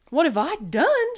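loud, high indignant retort; cross. A narrator's reading. Armadillo stuck in Sloth's chair, cross. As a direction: throw the blame right back, crossly. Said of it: "said Armadillo crossly".

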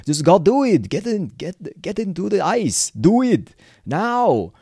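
put-on terrible Arnie-style accent, high and loud; hammed-up imitation accent, ending on slow, drawn-out commands; Arnold Schwarzenegger accent. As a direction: bark it in a bad Schwarzenegger-like accent. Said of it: "A bit like Arnie".

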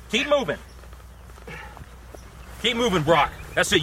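usual speaking voice

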